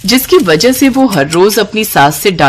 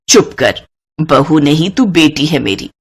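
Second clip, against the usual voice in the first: lower, affectionate hush; tender scolding; 3 semitones lower than usual. Acted. Mother-in-law comforts bride, tender and low. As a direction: soften her gently.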